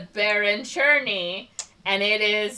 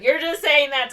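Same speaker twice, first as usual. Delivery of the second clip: raised, louder accusing retort; mock upset; 8 semitones higher, 8 dB louder than usual. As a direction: throw it back as a hammed-up lover's quarrel. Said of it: "gets upset at her".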